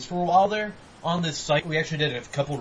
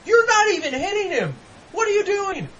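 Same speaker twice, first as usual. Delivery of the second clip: high, loud mock-exasperated shout; exasperated yelling; 6 dB louder than usual. Player yelling at computer soldiers shooting, exasperated high shout. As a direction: yell at useless teammates, voice pitched way up. Voicing his yell at teammates.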